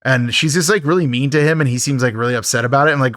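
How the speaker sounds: ordinary delivery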